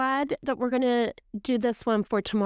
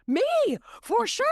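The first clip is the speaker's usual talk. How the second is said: playful emphatic admission; 13 semitones higher than usual. Retold or imitated. Quoting sister's answer.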